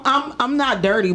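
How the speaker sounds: normal talking voice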